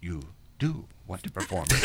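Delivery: soft, low, laughing insistence; Jedi mind trick. Retold or imitated. Cornelia insists he wants to perform, with a low, laughing Jedi mind trick. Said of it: "It's like a Jedi mind trick".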